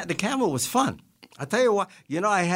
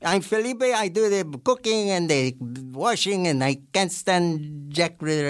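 Felipe; 3 semitones higher than usual. Acted. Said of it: "You were Felipe".

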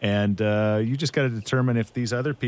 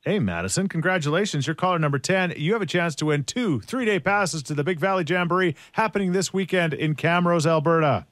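high, bright on-air DJ voice; radio contest promo; 11 semitones higher than usual. Acted. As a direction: sell the prize to the caller. On-air contest call with caller.

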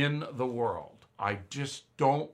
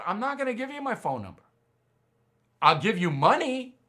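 higher and fast, incredulous mock outrage; exasperated retold rant; 8 semitones higher than usual. Refusing the clerk his phone number, mock-indignant and raised. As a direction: refuse her, half joking but heated. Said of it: "I'm not yelling at her".

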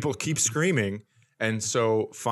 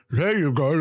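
lightly voiced sock puppet; 4 semitones higher, 3 dB louder than usual. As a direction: give the sock puppet a little voice. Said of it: "and then went".

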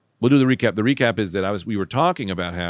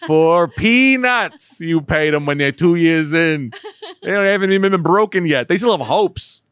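mocking rant; 7 semitones higher, 6 dB louder than usual. Mocking rant, loud and slow.

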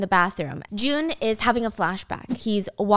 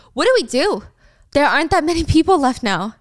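worried; 6 semitones higher, 7 dB louder than usual. Acted. [name] panicking.

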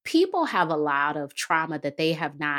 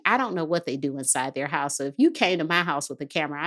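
ordinary delivery

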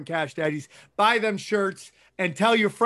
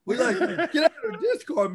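laughing; 10 semitones higher than usual. Real, not acted. Mocking the show's Discord crowd.

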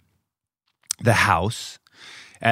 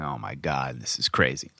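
low, much softer hush; 9 semitones lower, 7 dB quieter than usual. Retold or imitated. Husband hushing while cops search the kids' room, low and soft. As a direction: keep it down so the kids stay asleep.

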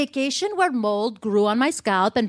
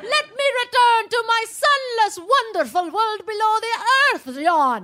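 melodramatic wail; 12 semitones higher than usual. Acted. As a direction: wail in mock despair. Overblown despair at breakup.

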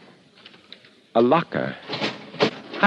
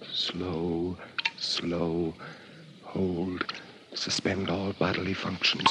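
soft, slow trance murmur; hypnotic murmur; 6 dB quieter, slower than usual. Acted. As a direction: lull yourself under, barely voiced. Self-hypnosis to fake death.

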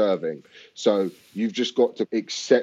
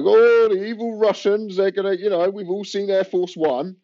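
mocking Western media; 11 semitones higher, 5 dB louder than usual. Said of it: "it's just basically like".

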